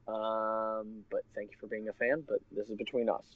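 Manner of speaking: a hint of a relaxed movie star; imitating Stephen Amell. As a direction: lightly play the actor leaving a video message. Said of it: "he makes a video and he goes".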